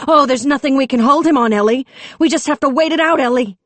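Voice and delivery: high, fast mocking singsong of the chief; mocking imitation. A narrator's reading. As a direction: parrot his excuses with scorn.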